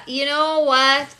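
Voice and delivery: ordinary talk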